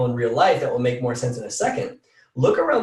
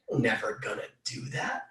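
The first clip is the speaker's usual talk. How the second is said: much softer and lower inner doubt; a lower, much softer voice that undermines you; 7 semitones lower, 12 dB quieter than usual. Acted. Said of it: "Just whispering doubt and insignificance".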